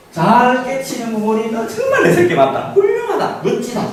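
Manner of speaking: high, excited praise with a laugh; mother gushing praise at her son; praising child. Shown as a demonstration. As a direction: gush over your child. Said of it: "가훈, 아이덴티티를 심어주는".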